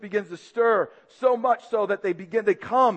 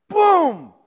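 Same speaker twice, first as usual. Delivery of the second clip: punch sound effect; 10 semitones higher, 9 dB louder than usual. Retold or imitated. Imitating a blow.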